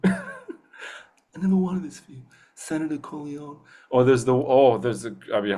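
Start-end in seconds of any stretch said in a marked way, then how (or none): 0.1-3.6 slow, high, hushed mob boss; slow mafia don impression; Godfather. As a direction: draw it out softly like a movie don. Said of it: "Do you have a Godfather impression?".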